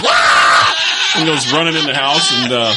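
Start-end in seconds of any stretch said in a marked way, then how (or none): 0.0-0.8 loud low crying wah; mock toddler wail. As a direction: belt a kid's bawl. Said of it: "he goes".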